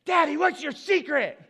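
put-on loud, high-pitched little-kid blurt; imitating a daughter who can't whisper; failed secret voice. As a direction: shout the secret back like an oblivious child. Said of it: "she didn't have a good secret voice".

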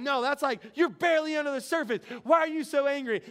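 insistent counselor. Retold or imitated. Acts counselor rejecting his excuse.

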